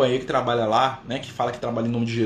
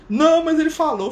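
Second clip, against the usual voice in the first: high, loud insisting protest; insistent protest; 6 dB louder than usual. Retold or imitated. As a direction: whine the excuse up high and loud.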